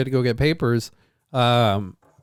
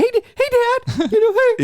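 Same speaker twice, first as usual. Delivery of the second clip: very high, louder excited dog; happy-go-lucky dog voice; 4 dB louder than usual. Pretend. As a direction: squeal it like a happy puppy. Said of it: "just like a happy-go-lucky".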